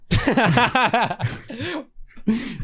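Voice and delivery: high, rolling real laugh; long high laugh. Real, not acted. Joking about hurting back from age, laughing. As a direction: laugh at your own misfortune.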